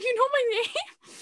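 high, slow, amazed question; can't believe she knows you; stunned disbelief. Retold or imitated. Fan stunned the host knows her name.

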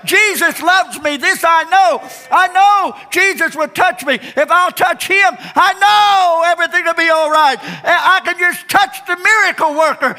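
high, loud certain cry; triumphant faith shout. Acted. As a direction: proclaim it with full conviction. Woman's faith rising to shout.

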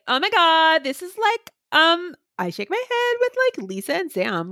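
Valley Girl impression. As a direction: do an airy Valley Girl with dragged words. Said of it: "I could do Valley Girl".

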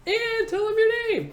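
high, loud and slow "tell him your name"; high slow singsong nudge; high drawn-out prompt. Retold or imitated. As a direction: nudge the little brother, singsong. Imitating sibling's prompt.

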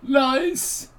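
high, loud hammed-up theatrical wails; melodramatic cries. Cohost bursting into mock tragic cries before the intro, high and hammy. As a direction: overact a soap-opera betrayal.

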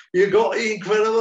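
loud, high Cockney street voice. Retold or imitated. Cockney kid asking a friend, Cockney accent. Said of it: "this street stuff Cockney accent".

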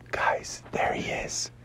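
tense hushed whisper; sneaking whisper. Acted. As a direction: keep it secret from the Joker. Spotting Joker, sneaking.

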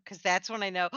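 usual speaking voice

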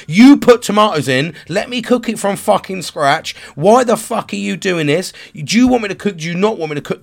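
loud, high-pitched angry rant; exasperated shouted accusations; going ballistic. Retold or imitated. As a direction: re-enact blaming your wife, voice pushed up. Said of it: "fucking going ballistic".

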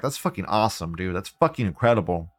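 ordinary talk

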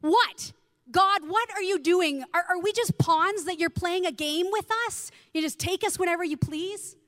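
loud, high, furious questions at God; angry venting. Retold or imitated. Grieving teen venting at God in the kitchen, loud and high. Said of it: "I started to vent my feelings".

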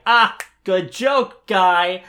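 high, loud and slow sarcastic drawl; mock unimpressed. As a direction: roll your eyes at an old joke. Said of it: "is like".

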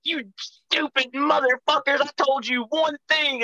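high, strained mock-furious scolding; retold cussing-out. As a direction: re-enact cussing out the helpers. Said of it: "and I'm fucking cussing".

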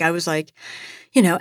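ordinary delivery

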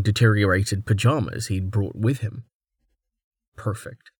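ordinary delivery, nothing marked

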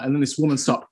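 usual speaking voice

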